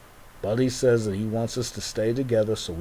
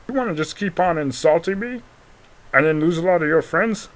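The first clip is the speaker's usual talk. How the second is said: higher and louder, hurt and accusing; high indignant voice; 7 semitones higher, 5 dB louder than usual. Pretend. Offended toy character warning Global, high indignant. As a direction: fire it back high and wounded.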